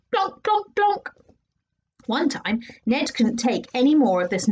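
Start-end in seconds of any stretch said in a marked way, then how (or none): 0.1-1.1 high plonks. A narrator's reading. Nose playing piano.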